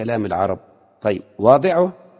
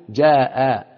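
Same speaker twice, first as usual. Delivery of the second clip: slow demo; 4 dB louder, much slower than usual. Shown as a demonstration. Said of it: "teacher demonstrating the word".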